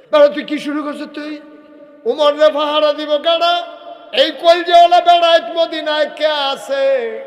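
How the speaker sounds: high, very loud indignant roar; high-pitched full-throated shout; shouted challenge. Acted. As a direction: hurl the challenge at full volume. Acted indignant challenge to the crowd.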